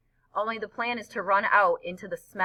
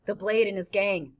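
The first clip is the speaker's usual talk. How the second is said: plain reading voice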